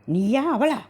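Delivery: high, pressing question; bewildered demand. Acted. Husband demands to know who she is, high bewildered. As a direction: force her to say which one she is. Husband confused about wife's identity.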